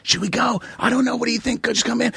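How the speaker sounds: high, soft imitated dithering; lightly voiced hushed friends deciding; hushed mock chatter. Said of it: "and they're like".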